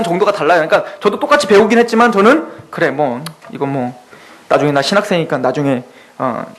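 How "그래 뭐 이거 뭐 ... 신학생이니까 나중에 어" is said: offhand indifference. Retold or imitated. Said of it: "이런 마음으로 배웠고".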